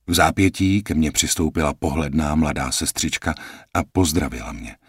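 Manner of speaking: ordinary delivery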